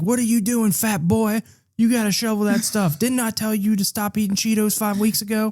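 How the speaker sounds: high-pitched drill sergeant tirade. Acted. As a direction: berate the recruit without a breath. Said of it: "he's really mean with it".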